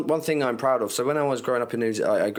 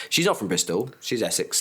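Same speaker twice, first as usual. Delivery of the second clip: usual speaking voice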